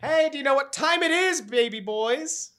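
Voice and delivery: loud, drawn-out announcer hype; hyped show intro calling the baby boys; hyped intro host. Acted. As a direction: announce it like a big show opener. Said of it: "I'm gonna play the music".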